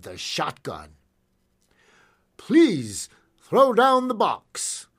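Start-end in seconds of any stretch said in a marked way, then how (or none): 2.4-4.9 slow, high, loud request; polite firm request. A narrator's reading. Masked gunman to second stage driver, slow raised request. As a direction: draw out the polite demand, slowly. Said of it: "the masked gunman requested".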